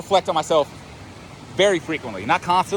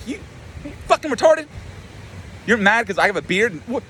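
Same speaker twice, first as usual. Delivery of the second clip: high-pitched scornful questioning; mock incredulous trash talk; 8 semitones higher than usual. He talks mad shit to himself, high incredulous scorn. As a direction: taunt yourself in disbelief, voice pitched up. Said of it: "I was talking mad shit to myself".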